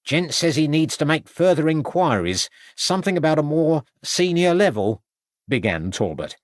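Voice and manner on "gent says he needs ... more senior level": higher, meek husband voice; nervous, eager to please; timid little man. A narrator's reading. Timid husband relays message.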